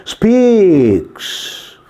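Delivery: one word drawn out long, pitch falling; teacher's slow, elongated dictation. Shown as a demonstration. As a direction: stretch the vowel so pupils can write it.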